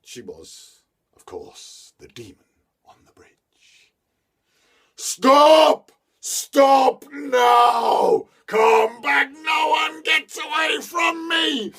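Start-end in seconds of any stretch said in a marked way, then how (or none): 2.8-3.9 hushed ominous reveal; whisper. A narrator's reading. Reveal of the demon. 4.9-11.7 high, loud roaring demon; demon shout. A narrator's reading. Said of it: "And her voice changed".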